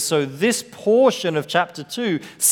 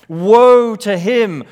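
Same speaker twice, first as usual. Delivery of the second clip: slow, louder lament, leaning on woe; each woe heavier than the last; solemn woe proclamation; 6 semitones higher, 8 dB louder, slower than usual. Acted. Preacher repeats the woe oracle.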